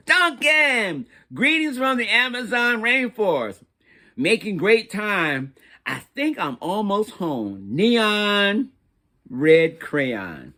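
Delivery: a breezy, upbeat holiday greeting; cheerful travelling crayon. Acted. Neon red crayon's travel postcard.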